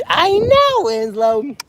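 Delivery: high, loud cooing. Real, not acted. Comforting pig Winslow, in high cooing baby talk. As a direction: soothe the pig with a sudden high coo.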